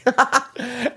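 a short, high, breathy burst of laughter. Real, not acted. Laughing at himself while ranting about downvoted stock, high laugh. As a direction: break into a quick high laugh. Laughing at his own rant.

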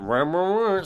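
garbled wah-wah noise, swooping up and back; distorted speech. Retold or imitated. Storyteller imitating the brothers' words as they distort. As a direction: imitate words smearing into mush. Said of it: "their words distort and get that".

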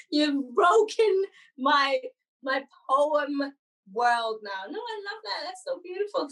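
ordinary delivery

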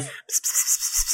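slow, whispered robot buzz; even machine gun syllables. Retold or imitated. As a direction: buzz each syllable evenly, unvoiced. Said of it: "That was a robot going".

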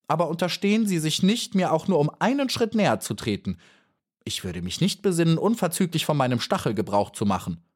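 angry warning. A narrator's reading. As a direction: warn her off with the sting. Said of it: "sehr ärgerlich geworden".

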